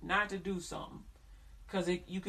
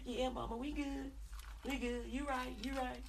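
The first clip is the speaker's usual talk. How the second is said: softer, sheepish "we good"; meek child; 3 semitones higher, 7 dB quieter than usual. Retold or imitated. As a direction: give in quietly to mama. Child meekly agreeing with mama.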